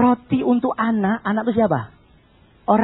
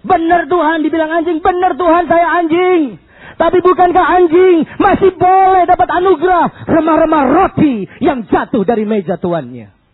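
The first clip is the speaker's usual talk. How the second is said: high, loud impassioned cry; fervent shouted plea to the Lord; woman's bold reply; 6 semitones higher, 5 dB louder than usual. Shown as a demonstration. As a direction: declaim it at full force, easing at the end.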